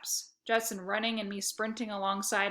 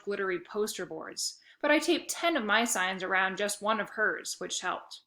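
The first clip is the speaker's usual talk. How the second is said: plain reading voice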